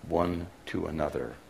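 usual speaking voice

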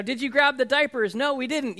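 mock hectic exchange at the car; frantic parents. Frantic packing, forgotten diapers.

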